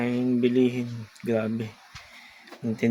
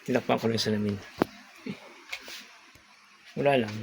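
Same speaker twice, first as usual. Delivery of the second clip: ordinary talk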